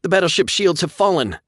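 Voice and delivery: high loud excited report. A narrator's reading. Officer reporting to captain, excited. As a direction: announce it thrilled.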